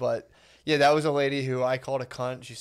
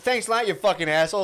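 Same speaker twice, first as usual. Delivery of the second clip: higher-pitched sarcastic driver imitation; mock angry retort; 7 semitones higher, 4 dB louder than usual. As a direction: throw it back as the aggrieved driver.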